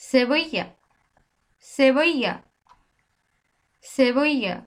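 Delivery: 「সেবইয়া」 said with an s and a y sound; Spanish Sevilla. Shown as a demonstration. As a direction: say 「সেবইয়া」 clearly, falling at the end. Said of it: "একটা উদাহরণ আছে দেখেন".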